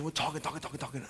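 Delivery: usual speaking voice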